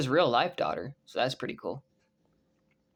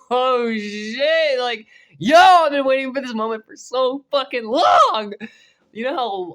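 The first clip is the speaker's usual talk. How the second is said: screaming with fan excitement, much higher and louder; hyped shout; 11 semitones higher, 12 dB louder than usual. Real, not acted. Fan yelling at viewers about the long-awaited crossover, hyped shout. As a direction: let it explode like a fan who finally got his wish.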